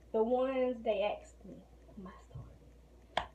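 breathless, winded; talking while out of breath. Real, not acted. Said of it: "So I'm out of breath".

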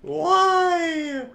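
high, drawn-out wail; high wail of frustration; anguished cry. Real, not acted. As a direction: cry it out at the game. Frustrated cry after losing fight.

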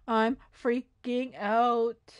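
"I'm freaking out" very slow, broken into chunks; slow, deliberate "I'm freaking out"; drawn-out freak-out. Pretend. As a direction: stretch "freaking out" out word by word. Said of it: "How many times am I gonna say I'm freaking out".

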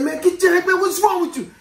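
high-pitched indignant protest; retold protest. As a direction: protest as if being manhandled, half laughing. Retold protest while pinned to lockers.

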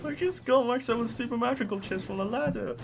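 can't contain the delight; giddy happiness. Real, not acted. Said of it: "Why am I so happy?".

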